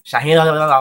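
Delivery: loud, high garbled imitation; too-fast gabble. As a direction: mimic someone rattling off a name too fast. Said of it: "He was going too fast".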